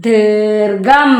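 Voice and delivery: ദീർഘം very slowly in two parts; slow model reading of ദീർഘം; reading demonstration. Shown as a demonstration. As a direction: stretch both syllables of ദീർഘം. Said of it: "ഇതെന്താ വായിക്ക?".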